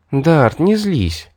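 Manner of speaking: slow kittenish soothing; sweet purr. A narrator's reading. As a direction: purr it out sweetly, drawing out the words. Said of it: "промурлыкала сладко".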